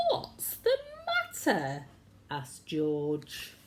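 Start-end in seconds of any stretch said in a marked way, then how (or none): 0.1-1.9 kind giant asking. A narrator's reading. George asks the dog.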